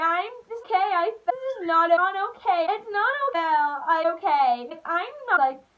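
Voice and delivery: high, loud, whiny mock sob; mock crying fit. Mocking her date throwing a fit after falling on the ice, mock crying. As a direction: ham up a grown man crying like a baby. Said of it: "had to throw a fit like a baby".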